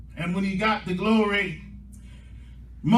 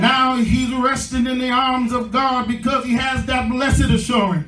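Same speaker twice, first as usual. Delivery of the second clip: loud, raised-pitch sermon climax; full-force preaching; 4 semitones higher, 6 dB louder than usual. Real, not acted. Preacher rallying the saints to a new beginning, full-force preaching. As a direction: drive each phrase home, stretching the big words.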